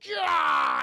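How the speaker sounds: high, loud hammed-up yell; mock battle cry. Logan leaping at the helicopter, mock battle cry. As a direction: roar it like a cheesy action hero.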